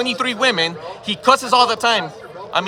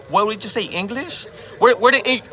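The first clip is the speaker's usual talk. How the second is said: usual speaking voice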